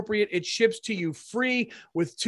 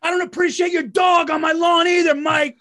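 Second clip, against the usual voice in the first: higher, louder cranky shout; mock neighbor yell; 8 semitones higher, 6 dB louder than usual. Neighbor yelling at Mike over his dog, hammed-up shout. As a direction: bark it across the lawn at Mike.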